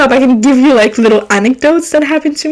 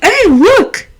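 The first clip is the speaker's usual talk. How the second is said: high eager boss pointing something out; lightly voiced eager boss; excited boss; 8 semitones higher than usual. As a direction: play the boss showing off his snack. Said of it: "And he's like".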